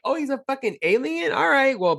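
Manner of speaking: high, playful delight; hammed-up glee at the alien; mock excited.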